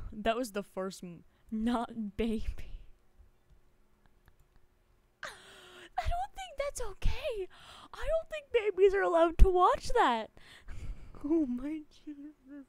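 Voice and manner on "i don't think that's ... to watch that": mock scandalized.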